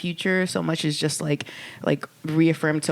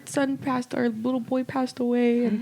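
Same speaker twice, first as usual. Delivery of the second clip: high, slow, pleading mock-tearful voice; a hammed-up distraught stranger asking for help; imitating grieving couple; 8 semitones higher than usual. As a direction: plead slowly and high like a heartbroken parent. Grieving couple asks for spray.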